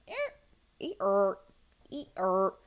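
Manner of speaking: jerky robotic glitch noises; short stuck bursts of noise. Shown as a demonstration. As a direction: stutter like a frozen, malfunctioning robot. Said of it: "like a robot glitching".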